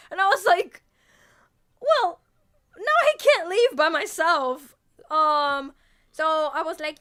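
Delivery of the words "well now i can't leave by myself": mock dismay. Retold dismay at Skid Row.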